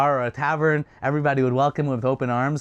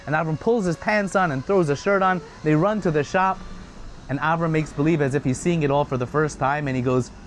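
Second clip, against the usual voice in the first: plain reading voice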